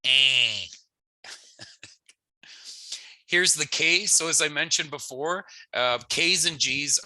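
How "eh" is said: eh said with a nasal twang; a nasal eh, N not sounded; nasally eh. Shown as a demonstration. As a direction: push eh through the nose. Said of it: "it's gonna be a nasally eh".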